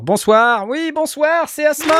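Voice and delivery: high greeting voice. Pretend. Greeting a guest in a put-on voice.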